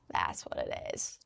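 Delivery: ordinary delivery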